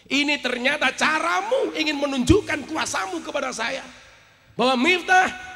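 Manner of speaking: preacher re-enacting an outpouring; retold impassioned prayer. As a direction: cry it out as a realisation in prayer.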